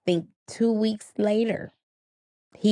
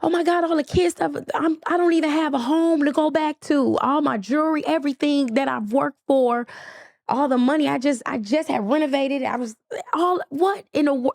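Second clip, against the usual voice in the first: frantic, panicked; 7 semitones higher than usual. Retold or imitated. Frantic call to her husband.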